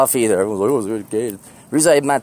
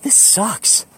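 higher, whiny half-asleep complaint; groggy whining; 8 semitones higher than usual. Retold or imitated. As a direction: moan it like a miserable morning person. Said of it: "I'm like".

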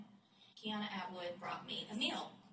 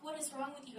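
much higher, mock-alarmed question; alarmed husband; 8 semitones higher, faster than usual. Husband finding his wife weeping, higher mock alarm. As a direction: retell the husband's startled worry. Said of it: "He was like".

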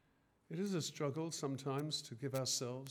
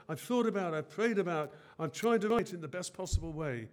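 higher, louder impassioned sermon; preaching at full force; 5 semitones higher, 6 dB louder than usual. Acted. Preacher voicing Paul's love for the churches, impassioned. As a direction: drive it home like Paul himself.

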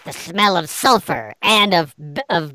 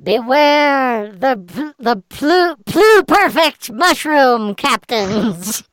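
higher, slow, ominous gargoyle call; gargoyle warning; 7 semitones higher, 4 dB louder, slower than usual. Acted. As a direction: call out a grave final warning. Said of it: "the gargoyle's voice behind you call out one final warning".

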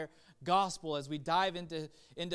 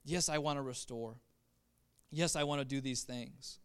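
a hint of a gentle, compassionate Jesus; tender Jesus; 6 semitones lower, 6 dB quieter than usual. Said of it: "But he's like".

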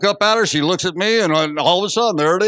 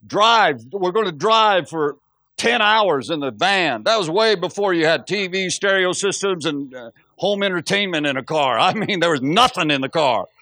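ordinary talk, nothing marked

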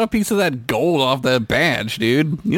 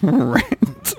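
high, baffled "Rent?"; puzzled high question; 11 semitones higher than usual. Retold or imitated. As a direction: ask "Rent?" as if the word is new. Mock puzzled Picard.

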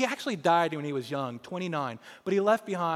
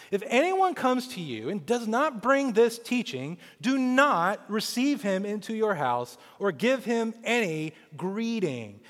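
emphatic scripture reading; 6 semitones higher, 5 dB louder than usual. Acted. Reads verse ten exhortation.

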